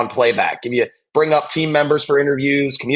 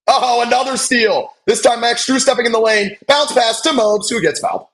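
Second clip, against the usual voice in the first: higher, excited live call; play-by-play; 9 semitones higher than usual. Acted. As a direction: call the action as it happens.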